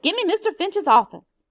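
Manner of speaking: shouting into the telephone; loud, urgent call. Acted. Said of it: "and shouted".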